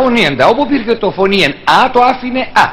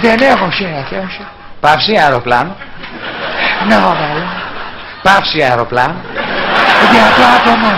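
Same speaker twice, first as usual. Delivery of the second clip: president impression with long pauses; mock speech with big gaps. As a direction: stop dead between phrases. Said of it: "το βάζουμε και τις παύσεις".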